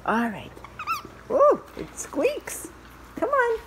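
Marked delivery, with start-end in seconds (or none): 1.3-3.6 squeaky high voice for the puppy. Real, not acted. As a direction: coo at the dog in a high sing-song. Cooing at dog over toy.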